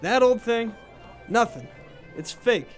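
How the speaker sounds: high-pitched dismissal; dismissive. Acted. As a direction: brush it off as worthless. Bartender waves off the ring's worth.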